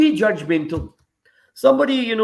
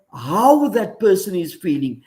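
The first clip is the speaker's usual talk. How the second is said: "how" hit louder than the words after it; stress on HOW; 3 dB louder than usual. Real, not acted. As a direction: lean firmly on the word "how". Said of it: "I'm going to emphasize the word how".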